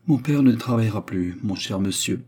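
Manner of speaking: ordinary delivery, nothing marked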